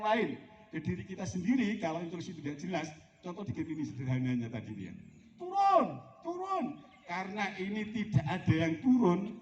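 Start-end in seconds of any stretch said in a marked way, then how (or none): 5.4-6.6 high, drawn-out command call; perintah lantang. Acted. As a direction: call out the order, pitched far up. Acting out an unclear team command. (aba-aba bernada tinggi)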